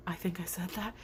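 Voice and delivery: soft unvoiced whisper; whispered aside. Real, not acted. Vlogger correcting herself, whispered aside. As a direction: whisper it to yourself.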